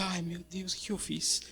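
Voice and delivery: almost whispered oh my God; barely voiced horror at himself; whispered regret after the outburst; hushed dismay. Retold shocked self-talk.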